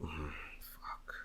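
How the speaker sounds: a torn, hushed curse; whispered curse. Real, not acted. Weighing two favorites, whispers in frustration. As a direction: hum then whisper the curse. Conflicted over final pick.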